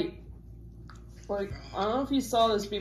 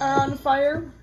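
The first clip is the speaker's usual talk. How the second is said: talking through a burning mouth; burned tongue; 5 semitones higher, 6 dB louder, slower than usual. Real, not acted. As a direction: mouth on fire from chili. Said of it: "My tongue is on fire".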